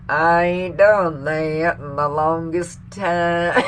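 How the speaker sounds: slow, exaggerated Southern twang; Hillary Clinton. Mocking her trip down south, twangy fake Southern accent. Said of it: "twangy Southern bullshit".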